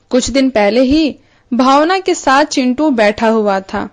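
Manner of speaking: ordinary delivery, nothing marked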